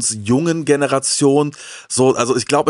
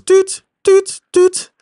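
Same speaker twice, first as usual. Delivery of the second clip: high, loud electronic beeps; imitated scanner beeps; 6 dB louder, much slower than usual. Man retelling the supermarket checkout of his toys, imitated scanner beeps. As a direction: mimic a checkout till beeping item by item.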